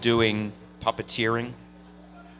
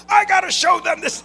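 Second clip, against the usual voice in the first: much higher, loud hammed-up shout; father blowing up; 7 dB louder than usual. Father yelling at son about the phone bill, hammed-up shout. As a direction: explode like an outraged dad. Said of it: "He just blew up at me".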